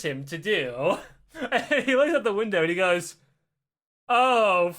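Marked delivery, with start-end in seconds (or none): 4.1-4.7 long, drawn-out groan of realisation; mock dread. Jerry spots red flags everywhere, mock dread. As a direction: stretch each word in comic dismay.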